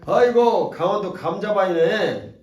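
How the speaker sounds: hammed-up loud teasing; loud mock exclamation. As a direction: tease him loudly and slowly.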